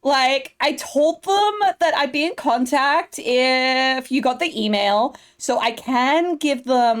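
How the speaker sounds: put-on bubbly Valley Girl assistant. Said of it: "doing that Valley Girl voice".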